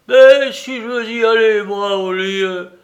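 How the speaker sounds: plus aigu et plus fort, vexé; vieux buté qui riposte. Acted. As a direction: proteste comme un vieillard entêté. Soupe riposte, dressé sur ses ergots. (Soupe riposte à Larrier, aigu et vexé)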